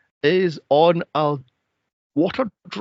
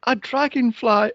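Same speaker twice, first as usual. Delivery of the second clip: mock airy girl; 9 semitones higher than usual. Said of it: "And she's going".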